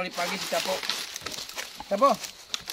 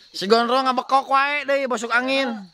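loud complaint; 9 semitones higher, 6 dB louder, slower than usual. Real, not acted. Yelling complaint.

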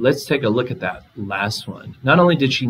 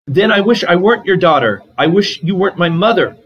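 louder and higher, a shouted read-aloud line; 8 semitones higher, 6 dB louder than usual. A narrator's reading. Jing Mei shouting back at her mother, a loud, high read-aloud shout. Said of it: "I shouted".

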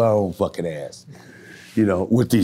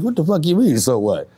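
loud, high, incredulous retort; retold angry retort; 10 semitones higher, 6 dB louder than usual. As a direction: fire back in disbelief, loud and high.

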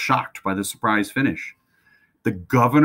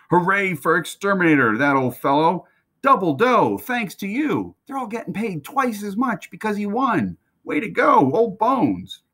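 cheering stablemen; 11 semitones higher than usual. Acted. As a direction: whoop it up like a crowd of grooms. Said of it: "the men cried".

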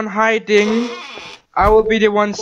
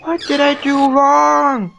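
fading panic; 6 semitones higher, slower than usual. Real, not acted. Caught, calming down.

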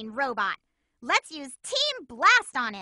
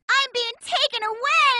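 alarmed cry; 10 semitones higher than usual. Acted. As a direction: cry out as you're carried off. Captured by robot.

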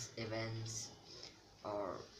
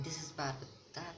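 usual speaking voice

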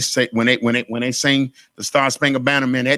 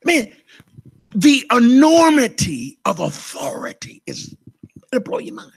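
awed whisper; 6 semitones higher than usual. Real, not acted. Awed aside to congregation.

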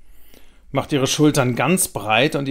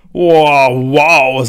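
amazed; 10 dB louder than usual. A narrator's reading. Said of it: "sagt Thomas".

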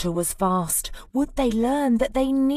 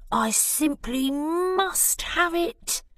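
a lightly voiced longing squirrel, a touch high; wistful squirrel; 7 semitones higher than usual. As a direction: sigh it out, wishing for the cone. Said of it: "he wistfully cried".

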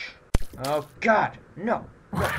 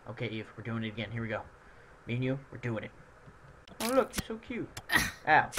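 ordinary talk, nothing marked

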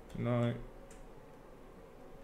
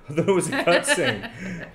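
laughing; 6 semitones higher, 7 dB louder, slower than usual. Real, not acted. Laughing at own mistake.

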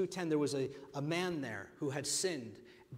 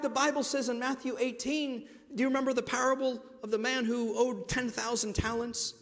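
normal talking voice

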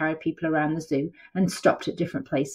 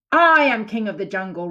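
higher, loud lion roar; 5 semitones higher, 5 dB louder than usual. Acted. Lion roars back at Tiger, loud and high. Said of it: "roared Lion".

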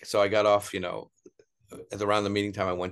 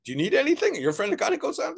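much higher, louder, fawning voice; eager mock tout; 4 dB louder than usual. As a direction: oversell it like a street hustler. Tout offers services.

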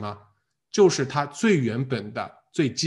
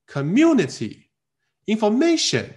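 "Community" and "information" slow, hoisted high; misplaced, lifted stress; 8 semitones higher, 4 dB louder, much slower than usual. Retold or imitated. Teacher imitates students missing the stress, "Community", "information" lifted high. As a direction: overdo the lift in "Community" and "information". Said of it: "很多同学找不到啊".

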